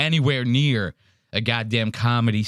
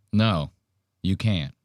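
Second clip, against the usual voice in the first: usual speaking voice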